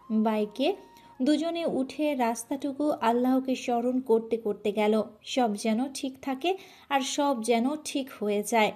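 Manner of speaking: ordinary delivery, nothing marked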